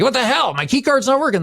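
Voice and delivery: mock-irate customer, pitch jumping up; all angry. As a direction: flare up in exasperated complaint. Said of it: "And I'm all angry".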